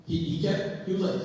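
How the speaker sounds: usual speaking voice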